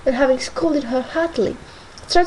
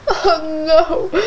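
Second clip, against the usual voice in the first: wailing; 3 semitones higher, 4 dB louder than usual. A narrator's reading. Young lady wailing at her sister-in-law. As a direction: wail it out through tears. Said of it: "wailed the young lady".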